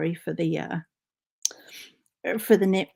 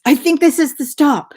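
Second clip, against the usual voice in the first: higher, louder put-on voice for Bob; imitating Bob; 6 semitones higher, 10 dB louder than usual. Bob announcing the stop to the family, in a loud high put-on voice. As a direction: play Bob sure of himself, loud and high. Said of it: "Bob says".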